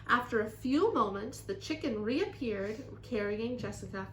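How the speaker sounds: put-on lively, expressive children's story reading; story read-aloud. A narrator's reading. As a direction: read to children with strong emphasis.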